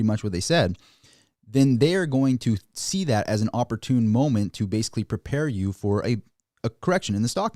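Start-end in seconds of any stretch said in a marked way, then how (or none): none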